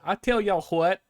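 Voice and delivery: hammed-up Southern twang; Southern accent. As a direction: lay the Texas accent on thick. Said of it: "You gotta hit 'em with the".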